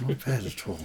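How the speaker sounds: a quiet imagined nod of approval; imagined approving poet. Retold or imitated. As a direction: murmur it like a pleased master. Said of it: "I could hear the Gawain poet saying to me".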